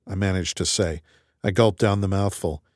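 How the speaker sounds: normal talking voice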